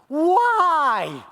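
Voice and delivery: high, very loud wail; deep heart cry. Acted. Jesus abandoned on the cross, deep heart cry. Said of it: "It's a deep heart cry".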